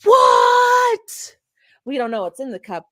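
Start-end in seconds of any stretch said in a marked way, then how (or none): 0.0-1.4 shocked shout. Real, not acted. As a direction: gasp then a high shriek. Spots a cup, shouting.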